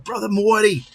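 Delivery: high, loud Boston-style hail; imitating a Boston accent. Retelling a viewer's greeting in a mock Boston accent. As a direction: sing out the name like a guy from Boston. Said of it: "that cool of an accent".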